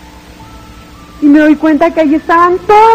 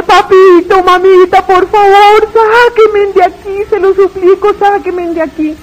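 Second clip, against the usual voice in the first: desperate cry; 4 semitones higher than usual. Retold or imitated. To her dead parents above the pit: desperate shouting. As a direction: beg your parents to pull you out. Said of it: "Empecé a gritarle".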